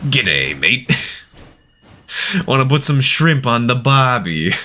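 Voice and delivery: hammed-up Australian accent. Shown as a demonstration. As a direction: greet them like a cartoon Australian. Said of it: "Stereotypes that get people to unsubscribe".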